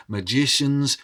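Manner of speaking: plain reading voice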